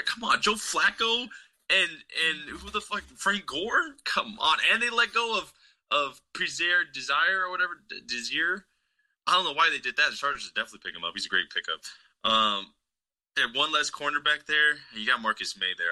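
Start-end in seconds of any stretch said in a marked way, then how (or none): 0.0-10.1 much higher, slower incredulous come on; disbelieving, pitched way up; exasperated rant. Real, not acted. Ranting about opponent's roster.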